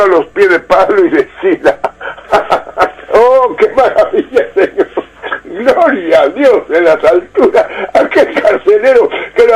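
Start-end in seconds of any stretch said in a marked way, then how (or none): 1.8-3.1 soft laugh. Real, not acted. Joyful laugh over Bible passage. 3.1-7.7 laughing with joy. Real, not acted. Rejoicing while reading Scripture.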